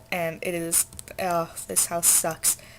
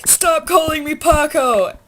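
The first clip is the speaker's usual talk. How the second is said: much higher, louder, slow indignant yell; retold yell; 9 semitones higher, 10 dB louder, slower than usual. A random student yells in Spanish class, indignant shout. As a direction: shout it like you're fed up. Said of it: "this random person yells".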